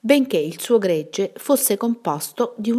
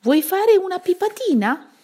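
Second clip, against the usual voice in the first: cajoling witch offer; 13 semitones higher, 5 dB louder than usual. A narrator's reading.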